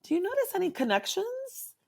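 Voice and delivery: high, light curious asking; a lightly voiced gentle therapist asking; gentle therapist question. Shown as a demonstration. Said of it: "it's saying".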